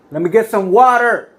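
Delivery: high, loud call across a crowd; yelling for help. Retold or imitated. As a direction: yell out urgently to bystanders. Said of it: "I yell to the people".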